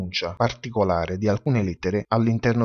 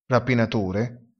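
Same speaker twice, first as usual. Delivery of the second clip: usual speaking voice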